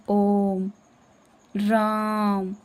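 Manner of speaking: ओम and राम stretched very long and slow; drawn-out pluta vowels. Shown as a demonstration. Teacher demonstrates long vowels in ओम and राम, very slowly. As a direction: hold the vowel of राम as long as a chanted mantra. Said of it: "कितना ज़्यादा समय लग रहा है ना".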